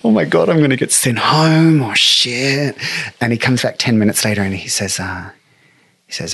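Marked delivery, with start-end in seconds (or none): 0.0-2.8 panicked thought. Retold or imitated. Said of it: "And I'm like".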